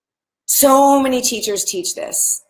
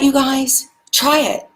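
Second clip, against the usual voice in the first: normal talking voice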